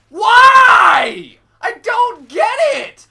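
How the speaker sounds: high, slow, outraged yell; exasperated shout. Real, not acted. Gamer screaming at the teammate who shot him, slow outraged yell. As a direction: bellow the why in disbelief.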